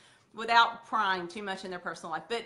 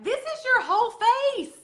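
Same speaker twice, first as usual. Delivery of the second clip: much higher and louder, slow, showy reveal; excited reveal; 14 semitones higher, 10 dB louder than usual. Retold or imitated. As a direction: present it like a magic trick. Showing the compact to customer.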